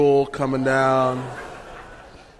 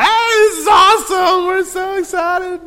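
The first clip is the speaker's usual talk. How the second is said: high, loud hyped-up cheer; over-caffeinated teenage cheer; wired excitement; 14 semitones higher, 5 dB louder than usual. Retold or imitated. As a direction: shout it like a teen on six coffees. Wired teens greeting breakfast.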